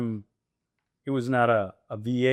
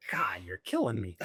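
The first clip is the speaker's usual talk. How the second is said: low, softer weary groan; exasperated groan; 4 semitones lower, 8 dB quieter than usual. Retold or imitated. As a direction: let him sag under it, mock exasperated. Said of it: "he'd be like".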